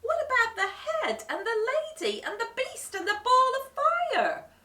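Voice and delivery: high, loud indignant cry. A narrator's reading. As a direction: cry out all the tricks. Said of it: "cried the friends".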